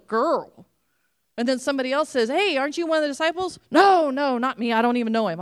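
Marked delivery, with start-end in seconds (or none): 2.3-3.6 quick, higher, pointed question; lightly voiced accuser; accusing girl. Servant girl accuses Peter. 3.7-5.4 loud, hurried no, no; acted panicked denial; frantic denial. Peter denies knowing Jesus.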